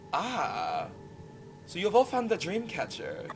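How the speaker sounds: put-on accented pistol-wielding boss, softly spoken; accented villain. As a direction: greet them with quiet, lilting menace. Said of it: "making fun of my accent".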